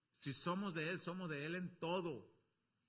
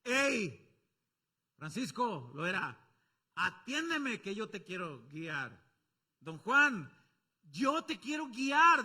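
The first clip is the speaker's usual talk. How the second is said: shouting out; 2 semitones higher, 8 dB louder, slower than usual. Acted. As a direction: shout a name across a crowd. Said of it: "El Espíritu Santo nos grita". (grito fuerte que llama por nombre)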